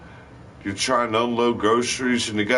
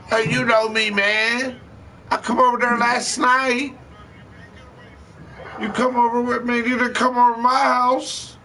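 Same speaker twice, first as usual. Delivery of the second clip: much higher, louder, pleading insistence; insistent dope fiend; 3 dB louder than usual. Retold or imitated. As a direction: swear you know him, desperate and high. Fiend insisting he knows him.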